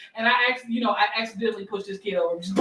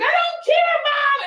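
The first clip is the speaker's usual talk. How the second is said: put-on very high, loud defiant child; bratty kid; 4 dB louder, slower than usual. Kid yelling back at his mom, high and bratty. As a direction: sass back like a brat. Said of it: "He's like".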